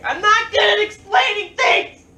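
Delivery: loud, high comic shout; exasperated mock outburst. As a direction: burst out yelling in mock frustration. Mock outburst over own rambling.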